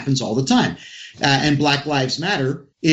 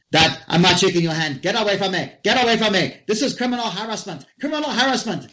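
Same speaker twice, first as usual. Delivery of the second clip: imitating a weak Jamaican accent, higher; badly faked Jamaican accent; fake Jamaican accent; 7 semitones higher, faster than usual. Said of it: "this fake Jamaican accent comes on".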